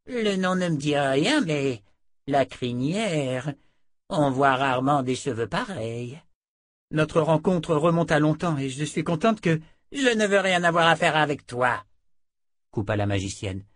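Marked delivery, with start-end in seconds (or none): 0.1-11.9 lightly voiced haughty sorceress; brusque sorceress. A narrator's reading. Sorceress studies Jensen's red hair, then brusquely refuses. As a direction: size her up, then cut her off.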